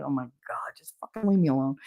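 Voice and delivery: quiet, understated exasperated aside; low-key mock annoyance; retold exasperation. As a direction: grumble it softly, as if to yourself. Retelling her reaction to fascinated coworkers.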